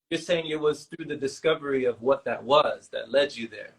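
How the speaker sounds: usual speaking voice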